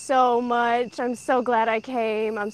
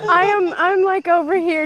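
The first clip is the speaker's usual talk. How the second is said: laughing; 5 semitones higher, 7 dB louder than usual. Real, not acted. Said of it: "disturbing my entire neighborhood".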